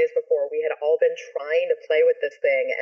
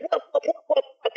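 high, repeated whoops mimicking spinning blades; imitated helicopter whooping; 10 semitones higher than usual. Imitating the helicopter spinning on the roof, whooping. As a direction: whoop like a stuck rotor. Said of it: "going like".

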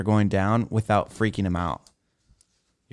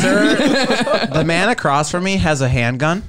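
giggling through a mock alarm call; laughing; 8 semitones higher, slower than usual. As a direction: laugh while reporting it. Joking call to flight attendant.

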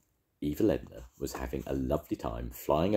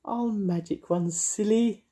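high, giggly witch voice; laughing witch voice; 4 dB louder, slower than usual. Acted. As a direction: tease them with a laugh. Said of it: "laughed Mavis".

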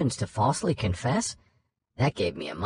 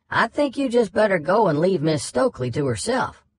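higher, louder stern southern judge; southern drawl; 8 semitones higher, 4 dB louder than usual. Retold or imitated. Judge orders the lawyer to leave the witness, southern drawl. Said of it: "impression of Dupree's southern drawl".

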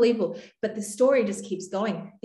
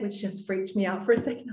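ordinary talk

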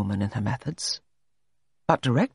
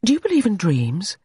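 abrupt question, pitched up; sudden question; 6 semitones higher, 3 dB louder than usual. A narrator's reading. John springs a question on Allegra walking in the park, suddenly. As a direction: blurt it out unexpectedly, higher. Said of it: "He said suddenly and unexpectedly".

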